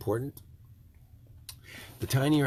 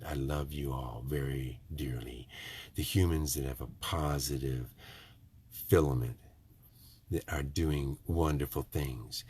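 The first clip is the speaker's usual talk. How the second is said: lower, softer, sincere heart-to-heart; out of his nasal voice; 8 semitones lower, 5 dB quieter than usual. Real, not acted. Dropping his usual voice to tell viewers he loves them, lower and softer. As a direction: drop the voice low and soft, speak from the heart. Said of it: "to get out of my Michigander nasal voice and to go ahead and say this".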